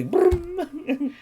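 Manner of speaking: high imitated strum, "brum"; rolled, high-pitched mock strum; ukulele strum. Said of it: "the strum of the ukulele".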